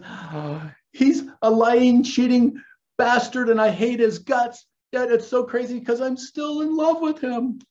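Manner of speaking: sigh, then bawl it in a hammed-up imitation; over-the-top tearful outburst; mock crying rant. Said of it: "And she's now crying".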